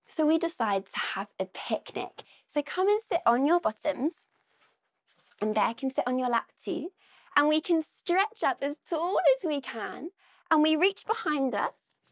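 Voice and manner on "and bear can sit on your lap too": ordinary delivery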